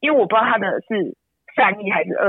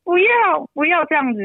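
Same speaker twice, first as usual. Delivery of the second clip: put-on a small boy comforting his crying mom; child's voice; 12 semitones higher than usual. Son comforting his crying mother, high childlike plea. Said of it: "他就过来".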